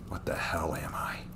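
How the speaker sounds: voiceless, soft, lost; anguished whisper. Acted. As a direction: whisper the question into the dark. Hero questions his own nature.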